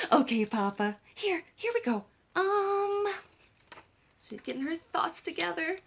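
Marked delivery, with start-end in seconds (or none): 0.1-3.1 high, excited fumbling start; eager start. Acted. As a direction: gather thoughts excitedly. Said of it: "Chicken begins story".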